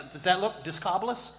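normal talking voice

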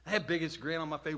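ordinary talk, nothing marked

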